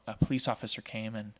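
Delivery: ordinary talk, nothing marked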